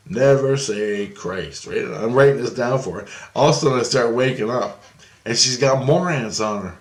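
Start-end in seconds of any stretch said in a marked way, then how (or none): none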